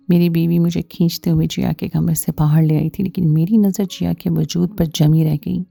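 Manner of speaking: usual speaking voice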